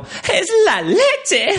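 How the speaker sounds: very high, slow, gleeful "¡Es la leche!" through laughter; dubbed film line. Retold or imitated. Quoting the Spanish dub of her favourite film, laughing. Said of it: "las frases como".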